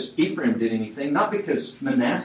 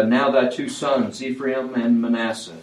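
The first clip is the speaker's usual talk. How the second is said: ordinary talk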